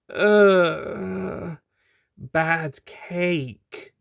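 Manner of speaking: high, groggy groan; dazed, drugged groan. Acted. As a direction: moan it as she comes round. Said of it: "she said in a groan".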